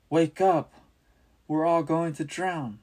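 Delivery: high, loud panicked shout; terrified shout. A narrator's reading. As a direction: yell in terror over the gale. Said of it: "the terrified disciples cried".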